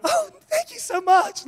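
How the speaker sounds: hammed-up polite thanks over a lousy gift; faked gratitude. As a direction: pretend you love the gift. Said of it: "fake it and be like".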